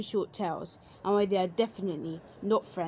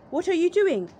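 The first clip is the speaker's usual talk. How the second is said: high, loud, curious bear voice; bear character voice; 9 semitones higher, 6 dB louder than usual. A narrator's reading. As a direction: pitch way up and ask it loudly, wide-eyed. Bear asks the fox.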